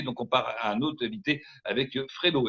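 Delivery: ordinary delivery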